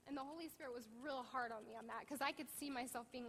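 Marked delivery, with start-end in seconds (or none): none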